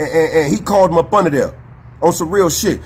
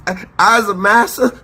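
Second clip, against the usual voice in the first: a hint of a groveling old-time servant, voice pitched up; mock slave voice; 6 semitones higher than usual. Mocking creators who apologized, lightly hammed-up groveling servant voice. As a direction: lightly ham up a pleading servant begging forgiveness. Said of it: "this how you really sound".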